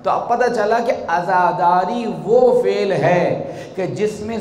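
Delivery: ringing preacher's voice, rising to a climax; impassioned preaching. Real, not acted. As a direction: build the point forcefully for the congregation.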